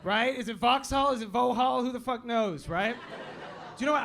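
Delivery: normal talking voice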